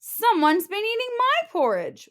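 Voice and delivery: high mock wail, stress on my; a hammed-up wail of loss; Mama Bear wailing over her porridge. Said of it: "wailed Mama Bear".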